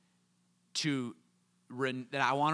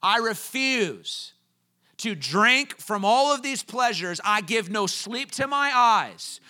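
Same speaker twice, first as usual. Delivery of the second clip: full-force preaching; 5 semitones higher, 8 dB louder than usual. Acted. Pastor urges the congregation toward David's vow, preaching loud and high.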